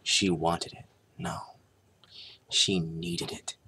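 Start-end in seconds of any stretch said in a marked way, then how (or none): none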